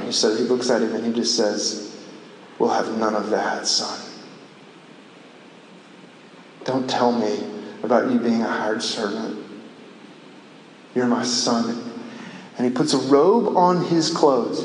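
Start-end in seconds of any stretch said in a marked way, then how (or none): 2.6-11.9 low, soft acted father's voice; quietly voiced father; tender fatherly reassurance. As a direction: hush it down, like a father gently settling his son. Preacher voices the forgiving father.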